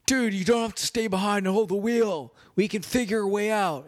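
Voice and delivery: mock-earnest Nick Cage begging his friend; comic movie-scene Nick Cage. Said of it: "And Nick Cage is like".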